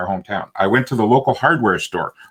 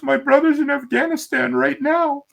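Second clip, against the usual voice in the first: high, teary woman's voice; tearful woman. Retold or imitated. Crying customer tells the author about her brother, high tearful voice. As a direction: let her voice catch as she answers. Crying lady's reply retold.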